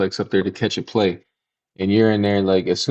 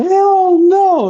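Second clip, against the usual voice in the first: shrill high hell no; squealed refusal; 7 dB louder than usual. Retold or imitated. Recalling the ugly girl, squealing high and loud. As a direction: shriek it in disgust.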